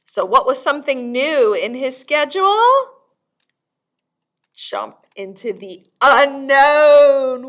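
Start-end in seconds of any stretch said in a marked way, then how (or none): 4.5-7.5 slow and loud, a bold dare; bold dramatic leap. A narrator's reading. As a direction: read it like a brave jump into nothing. Daring leap at story's climax.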